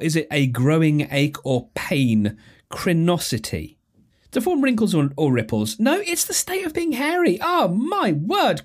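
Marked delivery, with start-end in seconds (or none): 5.8-8.6 high-pitched cry of disbelief; exasperated disbelief. Real, not acted. Quiz player learning the real definition, high exasperated disbelief. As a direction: squeal it, appalled at the answer. Wrong again at the quiz answer.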